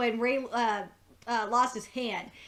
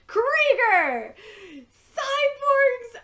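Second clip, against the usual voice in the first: high, loud gleeful exclamations; excited shout; 11 semitones higher, 7 dB louder, much slower than usual. Real, not acted. Fan revealing who Barry calls, excited shout. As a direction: announce it like a big reveal.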